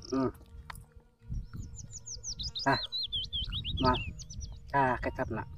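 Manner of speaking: ordinary delivery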